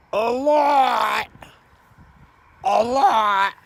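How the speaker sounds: shouting. Acted. Emphatic repetition building to shout.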